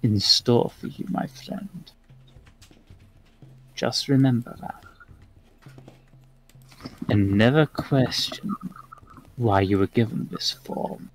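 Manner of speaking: put-on soft, measured old man with pauses; kindly old man. Old man reassuring the one he just healed, softly. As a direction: let each phrase trail off softly.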